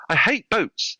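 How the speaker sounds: high-pitched mock distress; upset client. Retold upset client complains.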